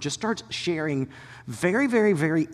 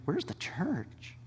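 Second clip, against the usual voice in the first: slow, soft dismayed question; softly, in slow dismay; dismayed hush; 11 dB quieter, much slower than usual. Retold or imitated. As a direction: ask it under your breath, heartbroken. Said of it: "I'm watching this as a pastor going".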